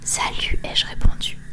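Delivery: ordinary talk, nothing marked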